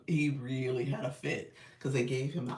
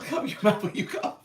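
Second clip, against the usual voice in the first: louder, laughing reminder; laughing scold; 5 semitones higher, 7 dB louder than usual. Shown as a demonstration. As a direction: laugh as you repeat it louder. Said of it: "You said".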